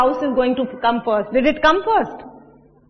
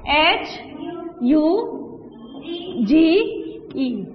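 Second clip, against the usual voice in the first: H, U, G, E, each letter alone with a pause between; spelled letter by letter; 4 dB quieter than usual. Shown as a demonstration. Teacher spelling "huge" for the class, letter by letter with pauses. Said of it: "How do you spell huge?".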